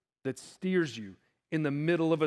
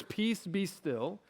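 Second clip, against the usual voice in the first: soft, slow soothing words; calm and gentle; 6 dB quieter, slower than usual. Acted. Preacher voicing his imagined kindly Jesus, soft and gentle. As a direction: say it gently, slowly, hushed. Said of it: "Jesus being calm and gentle".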